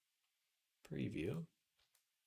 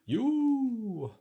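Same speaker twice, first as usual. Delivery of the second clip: drawn-out high yell; 13 semitones higher, 7 dB louder than usual. Pretend.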